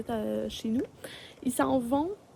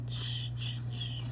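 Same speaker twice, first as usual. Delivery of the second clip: soft unvoiced shush; 9 dB quieter, much slower than usual. Real, not acted. Calming the fussing baby.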